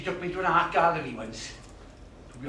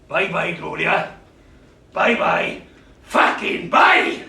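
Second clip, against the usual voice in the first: loud, slow, spat; bitter shout; 9 dB louder, slower than usual. Acted. Dumped man yelling after Gloria, bitter shout. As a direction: spit the farewell.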